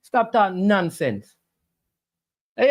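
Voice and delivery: ordinary talk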